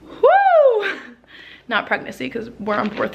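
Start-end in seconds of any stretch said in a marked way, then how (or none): 0.0-0.9 loud, very high "woo"; breathless whoo. Real, not acted. Vlogger catching breath after talking, high whoo. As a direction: blow off breathlessness with a high whoop. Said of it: "being out of breath?".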